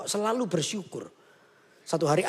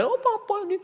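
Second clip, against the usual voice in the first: put-on complaining child; 7 semitones higher than usual. Mengeluh mulai.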